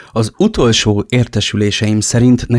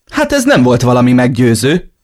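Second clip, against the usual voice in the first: hot-tempered, raised-voice retort; flaring up; 4 semitones higher, 7 dB louder than usual. Acted. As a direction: burst out in irritation. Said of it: "csattant fel indulatosan". (indulatosan felcsattanva)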